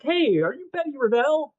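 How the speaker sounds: high, thin awkward nerd voice; nerdy kid voice. Retold or imitated. Jerry greeting the bully in a high nerd voice. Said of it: "he's like".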